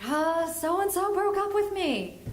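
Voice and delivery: high, drawn-out mock wail; mock hysterical teen. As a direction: wail it like a heartbroken teenager. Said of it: "And she's hysterical".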